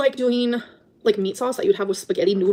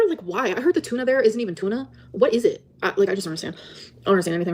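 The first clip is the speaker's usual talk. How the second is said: ordinary talk, nothing marked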